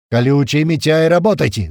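loud command rising in pitch; roaring. A narrator's reading. Commander ordering two snipers to fire, roaring. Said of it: "взревел Туман".

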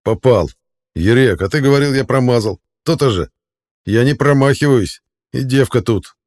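loud, cocky triumphant shouting; loud boasting. Acted. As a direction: crow over a kill.

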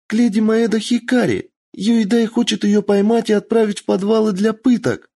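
put-on high girl shouting over wind; little girl voice. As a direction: call out like a scared little girl. Kitsune girl explains.

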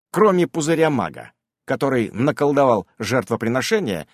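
plain reading voice

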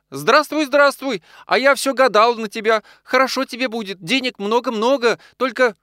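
high, eager woman's voice; excited high-pitched greeting; joyful wife. A narrator's reading. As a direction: chatter a delighted welcome in a high voice. Sick wife greets husband joyfully.

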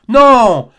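a sudden loud, high cry of no; cried out. A narrator's reading. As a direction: burst out with the denial. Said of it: "s'écria Barbicane".

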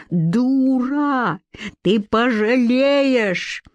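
high, slow furious roar; roaring rage. Acted. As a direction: bellow it out, drawn out. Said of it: "взревел так, что Ларюш задрожала".

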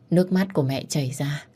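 ordinary delivery, nothing marked

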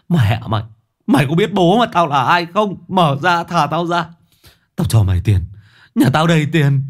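high, loud, cursing bluster; bully him with your father's name; angry threat. A narrator's reading. Captive cursing and bribing the jailer, angry and high.

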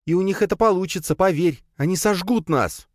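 fast, high-pitched outburst; angry growled warning. A narrator's reading. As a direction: fire back urgently in a woman's voice. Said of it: "затем прорычала". (злое, быстрое, высокое предупреждение)

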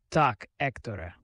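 ordinary delivery

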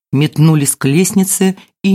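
plain reading voice

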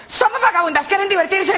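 high squawky parrot voice; shrill little bird calling out; cheeky parrot. Acted. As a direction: chirp it like a saucy parrot. Parrots repeat their line.

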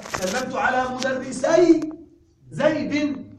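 slow, loud, زيد lifted high; slow emphatic example; slow model sentence. Shown as a demonstration. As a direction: read مدرسي زيد slowly with no nun. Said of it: "عند الإضافة".

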